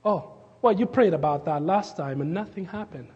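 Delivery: ordinary delivery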